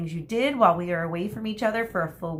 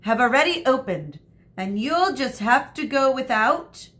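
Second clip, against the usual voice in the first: higher, louder stern warning; stern teacher; 6 semitones higher, 6 dB louder than usual. A narrator's reading. Teacher telling the class they'll go without her. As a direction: lay down the rule.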